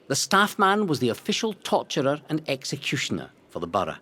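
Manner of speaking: usual speaking voice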